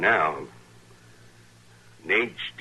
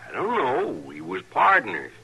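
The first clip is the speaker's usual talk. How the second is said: pleading; 10 semitones higher, 3 dB louder than usual. Acted. Prisoner pleading under questioning.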